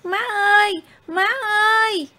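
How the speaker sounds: put-on boy yelling for his mother from the hill; calling out loud. A narrator's reading. Boy on the hill shouting to his distant mother, loud call. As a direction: call out across the valley, full voice. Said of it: "hét to lên".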